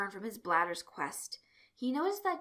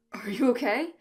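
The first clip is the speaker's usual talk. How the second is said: louder, higher startled check; startled and concerned; alarmed concern; 4 semitones higher, 9 dB louder than usual. A narrator's reading. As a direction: ask with sudden concern. Friend nearly falls off chair.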